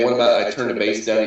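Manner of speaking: usual speaking voice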